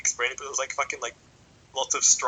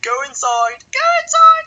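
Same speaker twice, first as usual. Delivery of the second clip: much higher, much louder rising screech; mock shrieking teacher; 12 dB louder, slower than usual. Teacher ordering him indoors, hammed-up screech. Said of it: "It's like".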